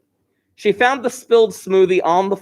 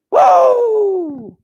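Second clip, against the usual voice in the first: long, high, loud whoop; excited whoop; 6 dB louder than usual. Real, not acted. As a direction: hype the class up with a big "whoa". Hyping up class to start.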